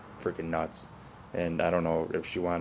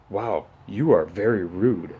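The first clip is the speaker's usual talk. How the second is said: slow, louder huffy voice, mocking her offense; mock indignant woman; 5 dB louder, slower than usual. Mocking the rude bed lady's huffy reply, slow and louder. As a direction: play up her outrage as you retell it.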